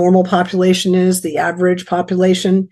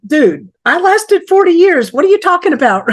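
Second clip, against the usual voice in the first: incredulous retort; 10 semitones higher than usual. Retold or imitated. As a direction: push back in high-pitched disbelief. Retold retort to being told.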